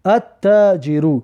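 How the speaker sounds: "التاجر" very slowly as at-taajiru, doubled T; silent L. Shown as a demonstration. Teacher demonstrating a sun letter, silent L in slow "التاجر". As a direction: draw out "التاجر" with the L merged into the T. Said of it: "لام نوشته میشه لیکن تلفظ کرده نمیشود".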